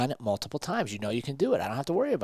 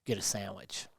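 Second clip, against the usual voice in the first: softer, lightly voiced lazy inner voice; tempting inner voice; 3 semitones lower, 8 dB quieter than usual. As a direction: coax it softly, a hint of the voice that wants you to quit. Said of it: "the bitch voice that tells you".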